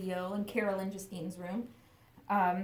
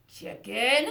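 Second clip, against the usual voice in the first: usual speaking voice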